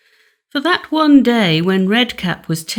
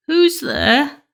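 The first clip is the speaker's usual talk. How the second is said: put-on old grandmother; 7 semitones higher than usual. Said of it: "asked the old lady".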